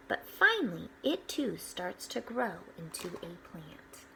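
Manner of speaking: bright storybook read-aloud; gentle storyteller voice; reading to children. Acted. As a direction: read it out like a picture book for the class. Reading picture book aloud to children.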